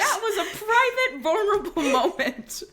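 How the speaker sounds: high, loud wail through laughter; mock-wounded, cracking up; laughing protest. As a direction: protest indignantly while helpless with laughter. Protesting leaked private video, laughing.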